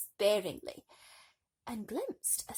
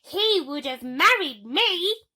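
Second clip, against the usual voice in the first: high, loud, slow grizzling whine; 9 semitones higher, 14 dB louder, slower than usual. Acted. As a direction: Gertrude complaining about her corset. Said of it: "grizzled Gertrude".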